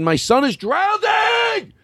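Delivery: high slow desperate yell; panicked shout. Retold or imitated. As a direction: cry out for a drowning son. Acted panic cry in library.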